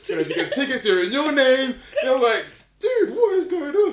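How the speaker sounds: high, loud, laughing; laughing excitement. Retold or imitated. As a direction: burst out, can't believe it. Excited friend on call.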